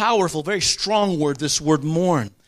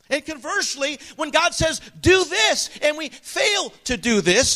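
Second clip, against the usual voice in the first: higher-pitched, emphatic pulpit voice; impassioned preaching; 6 semitones higher than usual. Real, not acted. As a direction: preach it hard, punching the key commands.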